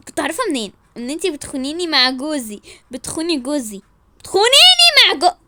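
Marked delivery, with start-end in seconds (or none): 4.2-5.4 loud, high-pitched accusation; outraged cry. Acted. Wife accuses rival of taking her husband, shrieking. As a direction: shriek it in disbelief.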